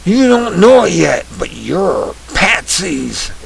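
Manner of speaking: usual speaking voice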